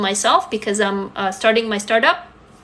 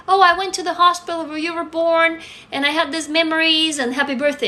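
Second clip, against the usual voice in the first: higher, sugary mother reading her own message; a lightly voiced mother turning sugary; sweet mom voice; 6 semitones higher than usual. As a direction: imitate a mom suddenly being sentimental.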